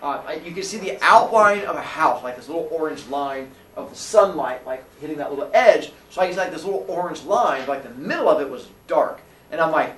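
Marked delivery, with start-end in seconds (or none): none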